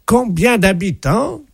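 pompous official greeting the mayor; mock ambassador voice. Prank caller as ambassador.

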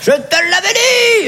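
very high, drawn-out shout; acted protest yell. Husband claiming years later he told his wife, high mock yell. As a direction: holler it in hammed-up exasperation. Acted quarrel between spouses.

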